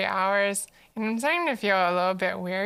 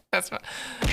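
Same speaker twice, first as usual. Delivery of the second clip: normal talking voice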